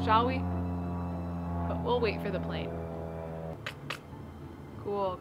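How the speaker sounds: usual speaking voice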